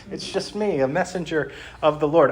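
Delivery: a touch of a cheery heavenly messenger; meme angel. Retold or imitated. Angel reassures the frightened human, mildly mock reassuring. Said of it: "and they're saying".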